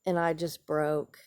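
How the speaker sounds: ordinary talk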